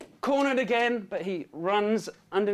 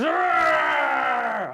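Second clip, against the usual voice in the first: loud, higher roaring cry of the name; a hammed-up beast bellow; dragon roar; 7 semitones higher, 6 dB louder than usual. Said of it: "the dragon goes".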